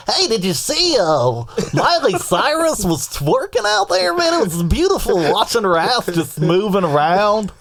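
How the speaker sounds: high, slow goofy announcer drawl; Harry Carey voice. Pretend. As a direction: ham up an old baseball announcer, slow. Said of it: "combination of Alan James and Harry Carey".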